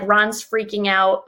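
ordinary talk

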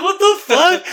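high, laughing mock disbelief; guy's reaction. Imitating a stunned guy watching a bear, laughing and high. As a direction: squeal it out through laughter. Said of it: "He's like".

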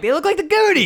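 high-pitched delighted exclamation; excited outburst. Retold or imitated. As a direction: blurt it out with sudden excitement. Excited reaction to the film.

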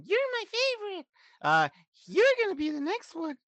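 high, breathy imitated pop-star voice; sweet high put-on voice; [name]. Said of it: "it's like saying, like, if [name]".

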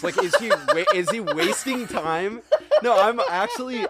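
high, giggling through the words; laughing. Real, not acted. Podcaster laughing at a pointless plot drug. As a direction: laugh while you get the question out.

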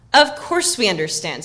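slow, loud, firm assurance. A narrator's reading. Merry assuring Frodo his friends understand, firm. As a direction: say it firmly, leaning on the words. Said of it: "said Merry firmly".

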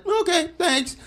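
very high, polite little reply; put-on high, compliant thanks; mock meek acceptance. Retold ancestors meekly accepting insult.

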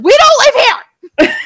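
loud, high yell of frustration. Retold or imitated. Yelling at the driver who went to the wrong venue. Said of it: "I yelled at him".

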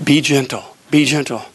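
low, deep instructions from God. Retold or imitated. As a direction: intone like a calm, low God. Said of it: "the still small voice of God".

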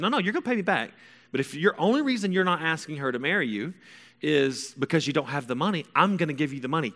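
high, brisk overruling; imitating a mom laying down terms; insistent mom. Mom offers son money to propose, insistent.

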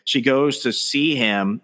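ordinary talk

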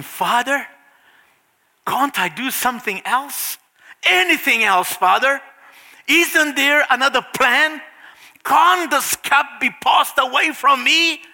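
pleading Jesus growing urgent; anguished prayer. Acted. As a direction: pray out in rising distress. Jesus praying in the garden.

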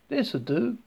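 high Southern decision; lightly voiced Southern woman; friendly Southern accent. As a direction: settle it in a Southern lilt. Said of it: "speaking in a friendly southern accent".

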